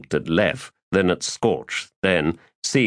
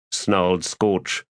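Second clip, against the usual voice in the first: ordinary delivery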